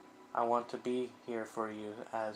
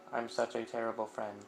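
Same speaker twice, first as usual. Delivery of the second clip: ordinary delivery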